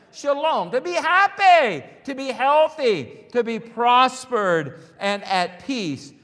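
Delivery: high, loud exultant preaching, easing down at the end; full-force preaching. Real, not acted. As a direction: proclaim each blessing loudly to the congregation.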